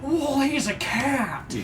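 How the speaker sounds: stunned bystander; amazed onlooker. Acted. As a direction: marvel at him slowly.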